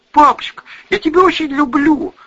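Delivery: high, loud emotional daughter; tearful. A narrator's reading. Daughter tells father she loves him, tearful. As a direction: pour out affection, pitch way up. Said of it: "Лиля заплакала".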